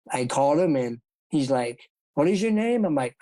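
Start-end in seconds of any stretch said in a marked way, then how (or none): 2.1-2.9 imitating the trainer. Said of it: "That's how he said it".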